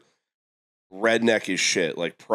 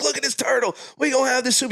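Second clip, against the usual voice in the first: much higher, hammed-up crowd voice; excited neighbors. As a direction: act out the whole block getting hyped. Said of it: "They're like".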